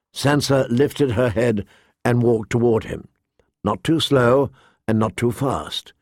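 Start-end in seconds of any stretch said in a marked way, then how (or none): none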